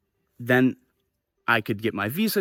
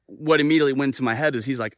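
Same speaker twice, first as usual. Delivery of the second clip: ordinary delivery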